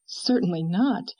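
plain reading voice

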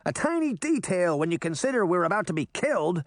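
shouted. A narrator's reading. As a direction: retort over noise without a full shout. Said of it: "the boy fairy shouted".

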